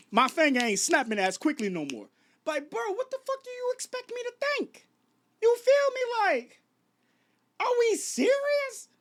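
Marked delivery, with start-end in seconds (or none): 2.5-8.9 very high-pitched, exasperated outburst; squeaky-high, incredulous demand. Real, not acted. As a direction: shoot the voice up high in disbelief.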